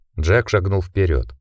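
ordinary delivery

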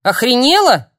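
loud, high bark of shock; barked retort. Acted. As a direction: snap back hard and loud. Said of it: "рявкнула Яна".

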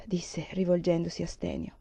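ordinary delivery

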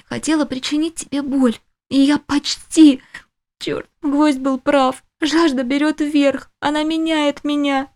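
слегка выше, взволнованно; голос девушки, борющейся с жаждой; женский голос героини. A narrator's reading. Героиня Делия говорит сама. (голос героини)

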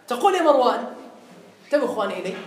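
ordinary delivery

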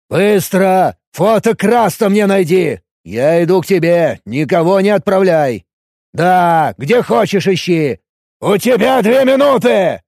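loud, high barked orders over phone; harsh barking commands. A narrator's reading. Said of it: "Рявкнул он".

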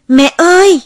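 high, loud desperate scream. Acted. Child screams for mother outside the locked house, loud cry. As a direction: scream for mother with all your strength. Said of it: "Tôi gào lên".

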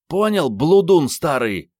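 loud, high, drawn-out scolding question; angry demand. A narrator's reading. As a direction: hurl the question at him, slow and furious. Armed woman threatens the cheating man.

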